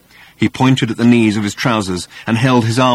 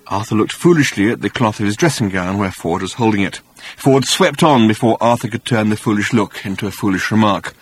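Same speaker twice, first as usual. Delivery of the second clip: ordinary delivery, nothing marked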